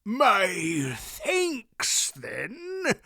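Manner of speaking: high wheezy cackle; cackling. A narrator's reading. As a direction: cackle it like a foolish old man. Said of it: "Wolf cackled".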